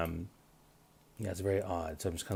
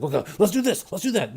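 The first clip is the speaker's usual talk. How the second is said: high, louder mock-frantic orders; mock hasty reaction; 9 semitones higher, 5 dB louder than usual. Showing listeners how not to handle a crisis, mock frantic. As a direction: act out jumping in without thinking. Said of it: "You can't just like".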